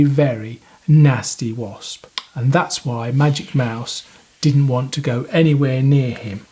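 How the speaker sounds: plain reading voice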